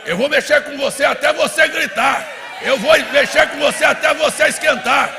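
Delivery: loud, high, shouted revival sermon; full-force preaching. Real, not acted. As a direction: hammer each phrase at the congregation at a shout.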